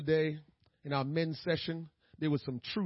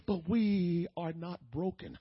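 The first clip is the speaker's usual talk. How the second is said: usual speaking voice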